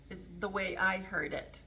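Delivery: ordinary talk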